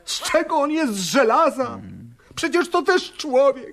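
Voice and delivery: indignant pleading for the rabbi; indignant outburst. Acted. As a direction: defend him, voice rising to a cry. Servant defends the exhausted tzaddik.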